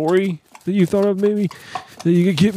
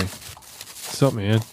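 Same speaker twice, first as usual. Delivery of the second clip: casual greeting; 7 semitones lower, 6 dB quieter, much slower than usual. Retold or imitated. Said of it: "I call that one guy".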